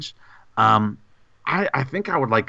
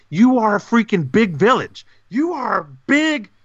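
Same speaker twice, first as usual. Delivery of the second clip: hyped praise; 9 semitones higher than usual. Acted.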